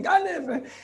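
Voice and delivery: mock yell of thief; retold cry of thief. Worshipper calling out thief in the shul. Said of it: "somebody started screaming".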